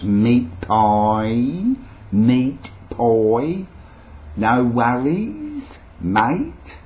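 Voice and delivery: slow, loud Aussie with rising endings; broad Australian accent. Shown as a demonstration. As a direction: stretch each phrase in exaggerated Australian. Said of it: "trying to emulate the, uh, Australian accent".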